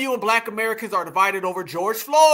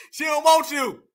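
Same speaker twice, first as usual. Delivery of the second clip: ordinary talk, nothing marked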